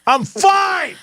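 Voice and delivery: high, hammed-up huffy insistence; mock-testy. Host playing husband answering a worried wife, mock-testy.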